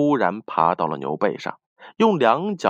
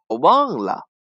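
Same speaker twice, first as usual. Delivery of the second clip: casual, brief brush-off; offhand; 3 semitones higher than usual. A narrator's reading. Mouse shrugging off the cat's question, offhand. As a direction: toss it off lightly. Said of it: "轻描淡写道".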